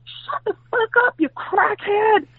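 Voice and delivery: high, shrill-pitched mock yell across the hall; neighbor yelling. Neighbor yelling at the crackhead across the hall, high mock yell. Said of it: "a lady from across the hall yells out".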